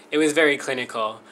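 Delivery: ordinary talk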